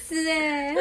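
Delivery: slow, drawn-out groan of being fed up; exasperated. Real, not acted. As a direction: drag it out, fed up. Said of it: "真的气死欸". (拖长声音说真的气死了)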